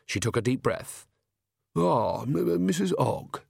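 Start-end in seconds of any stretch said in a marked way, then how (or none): 1.7-3.4 stammering, higher-pitched nervous welcome; desperate. A narrator's reading. Flustered king greeting Nanny Ogg, desperate. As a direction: stumble over the name, flustered. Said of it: "said Verence desperately".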